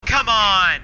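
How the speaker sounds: shout. Real, not acted. Gaming shout.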